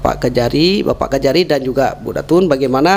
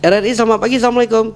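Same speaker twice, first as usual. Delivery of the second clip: calling out; 7 semitones higher, 3 dB quieter, faster than usual. Real, not acted. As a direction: call out to reach the caller. Host calling to a silent caller.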